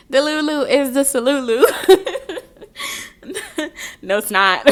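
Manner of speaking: loud, high laughing through the words; giggling loudly at her own joke. Real, not acted. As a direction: crack up mid-sentence.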